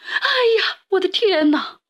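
high, frightened yelp; startled old woman's cry. A narrator's reading. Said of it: "瘦小的老妇人喊了起来".

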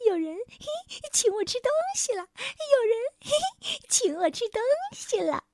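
high, squeaky, eager gabble; delighted little creature. A narrator's reading. Little man demands the king's coat for his dinner, eager high squeak. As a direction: gloat excitedly in a tiny piping voice. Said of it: "月光小人儿高兴地跳了起来".

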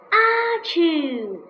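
a big sudden sneeze from the nose. Acted. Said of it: "Something pricked his nose".

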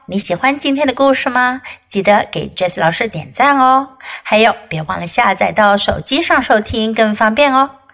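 ordinary delivery, nothing marked

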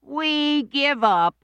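put-on high, slow kids' chorus; high children conceding; children giving up. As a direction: sigh it out like defeated kids. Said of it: "said the children".

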